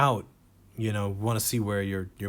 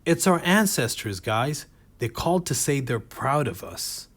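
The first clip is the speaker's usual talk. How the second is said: higher, lightly mocking earnest performer; mock dancer on phone; 5 semitones higher, 5 dB louder than usual. A stand-up mimics a dancer on a prop phone, mildly higher. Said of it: "picked up the phone and went".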